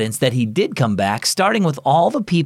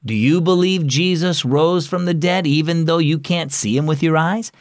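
ordinary delivery, nothing marked

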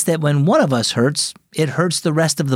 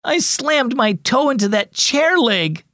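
higher, slow pained moan; 10 semitones higher than usual. Acted. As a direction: drag it out, hurting. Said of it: "moaned Adeline".

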